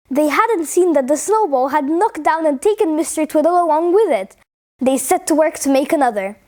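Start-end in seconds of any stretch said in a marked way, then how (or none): none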